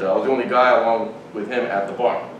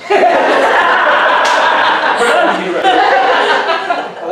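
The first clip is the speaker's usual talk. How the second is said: long, loud high cackle; big laugh; 7 dB louder than usual. Real, not acted. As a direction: burst into uncontrolled laughter. Huge laugh at price quote.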